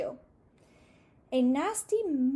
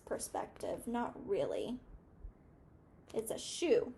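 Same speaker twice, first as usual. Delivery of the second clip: hushed aside; 2 semitones lower, 6 dB quieter than usual. Real, not acted. Teacher's hushed aside to children.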